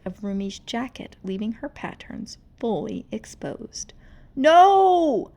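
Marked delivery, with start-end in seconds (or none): 4.3-5.3 high, loud shriek. Acted. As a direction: scream it out in terror. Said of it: "Rumi shrieked".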